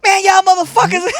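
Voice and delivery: high, loud put-on voice of a startled squatter. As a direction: play the woken-up guy yelling up the stairs. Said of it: "he goes".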